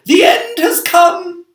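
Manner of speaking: very high, loud, slow wail of despair; panicked cry. A narrator's reading. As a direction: shriek it as if all is lost. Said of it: "Miss Spider cried".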